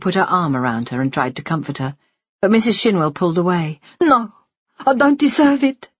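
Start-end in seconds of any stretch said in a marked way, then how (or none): 4.0-5.9 higher, lightly voiced weeping woman; a touch of tears in a high voice. Wife refusing the nurse's comfort, lightly weeping. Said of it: "she wept".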